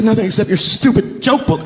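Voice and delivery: high, exasperated mock scolding; retold angry wife. Wife scolding husband over the empty box, mock angry. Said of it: "she was mad at me".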